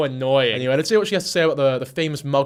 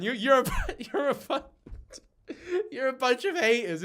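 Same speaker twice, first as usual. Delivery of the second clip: high, slow whiny mock voice; mock whiny murderer; 7 semitones higher, 4 dB quieter, slower than usual. Murderer calling the victim's family haters, whiny mock. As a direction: whine it like a sulking criminal. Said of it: "label their family as".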